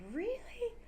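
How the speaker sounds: high, soaring "Really?"; surprised. Shown as a demonstration. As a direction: shoot "Really" up in disbelief. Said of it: "If we're surprised".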